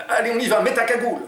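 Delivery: high, hurried voicing; urging robber. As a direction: hustle your partner into action. Jean urges Oumar.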